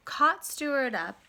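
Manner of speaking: ordinary delivery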